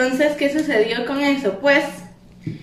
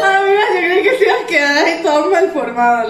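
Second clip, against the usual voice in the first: laughing; 4 semitones higher, 4 dB louder than usual. Real, not acted. Laughing hard at her drawing.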